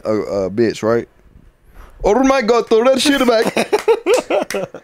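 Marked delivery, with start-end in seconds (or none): 2.0-4.8 high-pitched, hammed-up Indian accent; mock Indian accent. Imagining an Indian man in bed, mock Indian accent. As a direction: put on an exaggerated Indian man's voice. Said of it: "imagine you being an Indian man".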